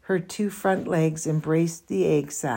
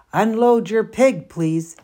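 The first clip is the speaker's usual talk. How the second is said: loud, higher PA call; loudspeaker call; 5 semitones higher, 7 dB louder than usual. A narrator's reading. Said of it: "said the loudspeaker".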